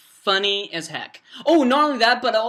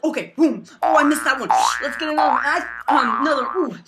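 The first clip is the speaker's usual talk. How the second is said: higher, louder play-by-play of himself; hyped-up re-enactment; 4 semitones higher, 6 dB louder, faster than usual. Retold or imitated. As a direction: act out each throw with gusto. Re-enacting his rock-throwing antics.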